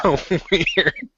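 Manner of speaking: laughing. Real, not acted. Laughs at the odd writing.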